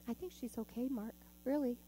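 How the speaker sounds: usual speaking voice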